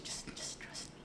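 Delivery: whisper. Retold or imitated. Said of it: "my brother was just".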